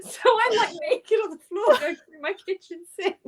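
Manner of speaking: high, giggling through the words; giggly retelling; laughing. Real, not acted. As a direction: laugh at your own story.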